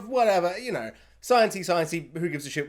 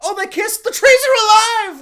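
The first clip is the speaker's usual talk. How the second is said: much higher, much louder, mock gushing; hammed-up delight, voice soaring on "alive"; mock excitement; 13 semitones higher, 10 dB louder than usual. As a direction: squeal it in sarcastic awe at the trees. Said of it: "It was more just like".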